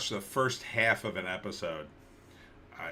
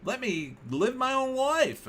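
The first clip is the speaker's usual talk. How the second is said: much higher, slower, sing-song reassurance; mock Wendy insisting; 12 semitones higher than usual. Host mimicking a celebrity's denial, high and breezy. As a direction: brush off worry in a high, airy voice. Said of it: "And then suddenly it was like".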